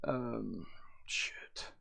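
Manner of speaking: breathy whisper to himself, under the breath; barely voiced curse as the word slips away; whispered aside. Real, not acted. A streamer forgets a word and whispers to himself.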